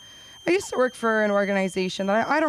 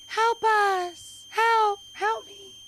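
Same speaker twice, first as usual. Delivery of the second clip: mock kid yelling; 13 semitones higher, much slower than usual. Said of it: "And he's yelling".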